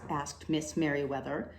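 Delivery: ordinary delivery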